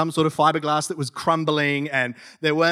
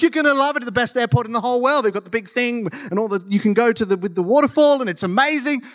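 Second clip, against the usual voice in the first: high, excited raving; imitated enthusiastic hype; gushing praise; 8 semitones higher, 3 dB louder than usual. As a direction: gush like everyone recommending it. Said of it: "Everyone's like".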